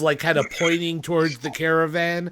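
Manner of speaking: ordinary talk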